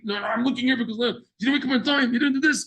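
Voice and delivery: scolding parent. Retold or imitated. Voiced parent scolding child.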